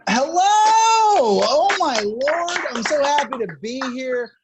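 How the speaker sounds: loud, high, drawn-out hello to the crowd; excited greeting. Real, not acted. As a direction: burst onstage with a big hello. Comic bursts on stage greeting crowd.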